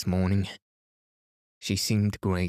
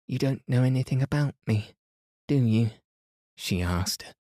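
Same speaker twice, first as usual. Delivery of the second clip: put-on lightly raised amused young woman; Mary's voice; 2 semitones higher than usual. A narrator's reading. Narrator voices Mary.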